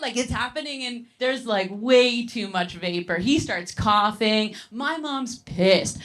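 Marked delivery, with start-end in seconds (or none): none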